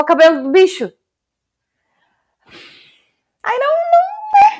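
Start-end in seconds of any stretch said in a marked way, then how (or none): 3.4-4.6 mock wail. Mock horror at the task.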